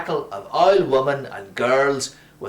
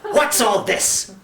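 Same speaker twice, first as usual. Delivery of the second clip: hissed; 7 semitones higher, slower than usual. A narrator's reading. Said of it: "the priest hissed".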